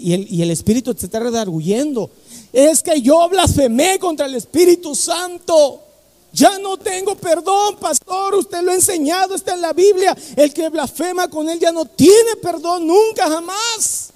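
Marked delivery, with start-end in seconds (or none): 2.5-14.1 high, anguished voice near tears; distraught plea. Retold or imitated. Girl crying to her pastor, high and anguished. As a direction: beg the pastor in despair. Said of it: "llorando a mares".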